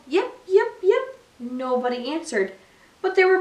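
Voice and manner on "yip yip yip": high yipping fox call; fox yips. A narrator's reading. Little fox calling toward the music, high yips. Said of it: "She called".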